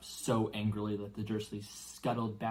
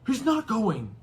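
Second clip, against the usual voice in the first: loud, high gruff grunt of refusal; 11 semitones higher, 9 dB louder, slower than usual. Acted. Giant refuses to let uncle keep the boy, gruff grunt. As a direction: plant your feet and refuse. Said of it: "Hagrid grunted".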